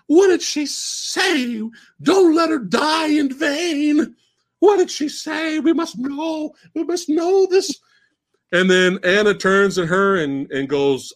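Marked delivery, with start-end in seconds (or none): 0.1-7.8 put-on high, slow, urgent old woman voice; cult leader. Cult leader demanding what the martyr said, high slow urgent voice. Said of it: "she shows up and goes".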